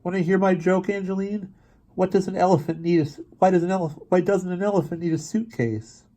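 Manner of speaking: put-on high, halting little-boy voice; stammering boy. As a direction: stumble through the joke like a nervous kid. Gary tells joke to Angeline.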